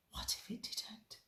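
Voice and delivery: breathy hushed whisper of a private fear. A narrator's reading. Narrator voicing Ruby's worried thought to listeners, whispered. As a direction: whisper it like a secret dread.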